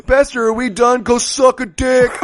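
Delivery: mock yell. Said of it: "And he yells".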